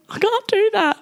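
high, squeaky-pitched refusal; aghast, voice shooting up high; retold panicked protest.

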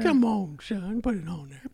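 high scolding granny voice; grandma voice. Acted. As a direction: urge him like a grandma. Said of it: "grandma voice".

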